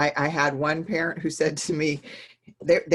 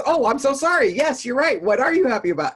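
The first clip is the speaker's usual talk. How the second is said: higher, louder, hammed-up sorry; mock apologetic; 9 semitones higher, 8 dB louder than usual. Dad apologizing to his three-year-old, higher and louder. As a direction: play the dad caught out by his toddler. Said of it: "And he goes".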